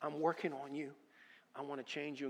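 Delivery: soft, hushed and reassuring; a gentle, loving God consoling his son; tender voice of God. Retold or imitated. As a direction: comfort him quietly like a loving father. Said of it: "He goes".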